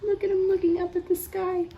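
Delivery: high, quick, amazed voice with a gasp; startled wonder. Acted. Showing children the bunny looking up, high startled wonder. Voicing bunny's alarm at rain.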